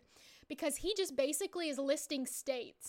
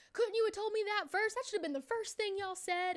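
mock indignant complaint; 6 semitones higher than usual. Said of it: "And he's like".

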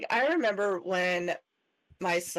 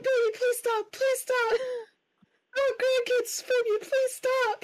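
high, pleading mother; pleading mom. Retold or imitated. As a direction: beg the child to stop, high. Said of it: "And I'm like".

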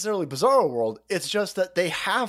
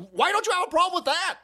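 high-pitched, exasperated demand; indignant outburst; 12 semitones higher, faster than usual. Real, not acted. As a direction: fire the question out in high-pitched outrage. Indignant rant at the news outlet.

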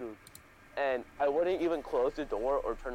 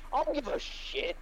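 squeaky put-on mom, much higher; high mock mom voice; 13 semitones higher than usual. Mom dismisses kid's excuse, high mock scold. Mom's imagined retort.

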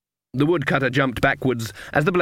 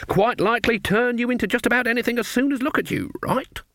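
very high, wide-eyed granny; sweet old lady; 11 semitones higher than usual. A narrator's reading. Granny plays the awed innocent to the woodcutter, very high. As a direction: gush it like a harmless old dear.

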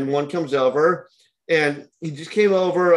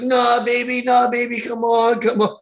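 sing-song pleading; 8 semitones higher than usual. Retold or imitated. Customer begs girlfriend not to leave.

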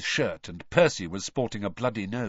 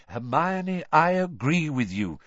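slightly raised, placating agreement; quick interjection; 5 semitones higher than usual. A narrator's reading. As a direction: cut in to placate the speaker. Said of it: "said Mister Weasley quickly".

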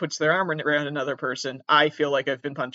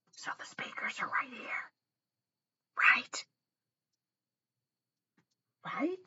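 whisper into the mic; 10 semitones higher, 12 dB quieter than usual. Shown as a demonstration. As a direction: test the mic with a close whisper. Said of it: "when I talk right into the keyboard".

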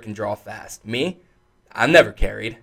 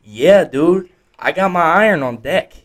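eager cowboy; 7 semitones higher, 4 dB louder than usual. Retold or imitated. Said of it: "He said".

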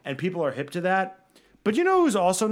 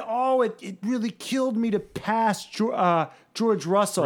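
mock Alonso; 5 semitones higher than usual. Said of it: "when he said".

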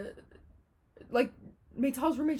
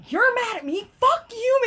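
high, loud indignant yell; retold bawling out; 13 semitones higher, 8 dB louder than usual. Girl bawling out her friend in the hallway, retold angry yell. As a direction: fire back in outraged mock fury. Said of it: "I'm bawling her out".

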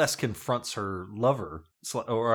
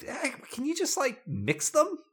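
high mock request. Retold producers, high voice.

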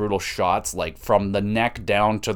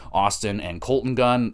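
ordinary talk

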